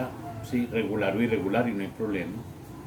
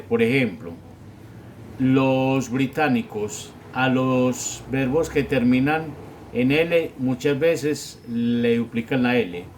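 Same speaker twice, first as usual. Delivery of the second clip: ordinary talk, nothing marked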